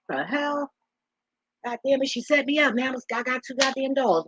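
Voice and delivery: high-pitched incredulous complaint; exasperated disbelief. Real, not acted. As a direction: sound set up and outraged. Dog tricked her, exasperated.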